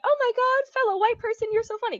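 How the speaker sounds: put-on high bubbly kid voice; naive child gushing. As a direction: gush like a clueless kid. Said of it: "I'd be like".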